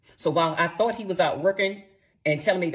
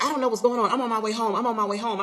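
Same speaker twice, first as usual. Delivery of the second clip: higher, louder mock husband protesting; insistent retold excuse, pitched up; defensive protest; 7 semitones higher, 5 dB louder, faster than usual. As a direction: imitate a flustered man making excuses. Retold quarrel, husband protesting on phone.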